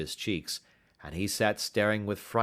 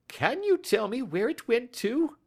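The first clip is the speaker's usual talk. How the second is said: cry. A narrator's reading. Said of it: "he cried".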